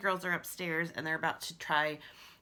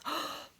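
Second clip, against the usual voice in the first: excited gasp; 3 dB quieter than usual. Acted. Discovers the echoing hallway.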